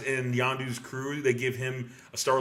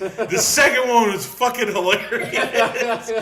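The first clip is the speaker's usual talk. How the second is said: laughing; 11 semitones higher, 10 dB louder, slower than usual. Real, not acted. Laughing while recalling scene.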